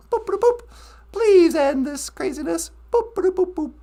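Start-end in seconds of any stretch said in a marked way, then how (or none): 0.1-0.6 high, chirpy mouth-made boops; computer beeps. Pretend. Playing holodeck, imitating computer beeps. 2.9-3.7 mock computer beeps.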